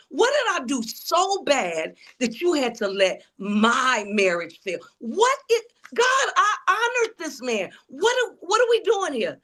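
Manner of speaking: high, wailing protest to God, re-enacted; anguished plea. Wife questioning God over her failed marriage, anguished plea. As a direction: plead up high, drawing out the complaint.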